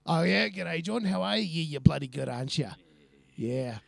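hearty matey customer answering his own questions; Kiwi supermarket regular. Retold or imitated. Friend retells a supermarket regular chatting up the checkout guy, in a mild hearty bloke voice. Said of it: "So he'll come through and be like".

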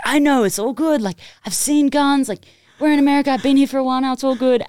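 high, loud mock self-assurance; breezy overconfidence. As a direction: insist you have it all under control. Acting out false confidence at range.

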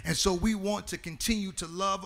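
rising into a sung, whooping preach; preacher lifting into song over the organ. Real, not acted. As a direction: carry it up and loud like a church climax.

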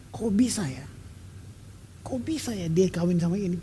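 near-whispered musing to oneself; soft, private disbelief; hushed wondering. Retold or imitated. Imagined hushed wondering at mixed marriages.

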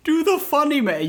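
put-on high, loud kid squeal; mock child. Cohost echoes the kids' request, high mock-child voice. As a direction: mimic a kid begging. Echoing the imitated kids.

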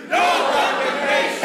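congregation shouting the phrase back; called out big. Real, not acted. Crowd answering the pastor, shouted call-back. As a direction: say it with your chest. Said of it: "Let's try it one more time".

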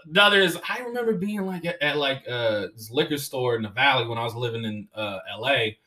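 ordinary talk, nothing marked

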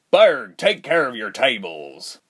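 slow, firm fatherly call; a stern father calling his son. A narrator's reading. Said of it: "his father called".

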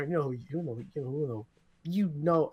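stumbling over the words; repeating words, stuck; stuttering. Real, not acted. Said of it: "I'm stuttering right now".

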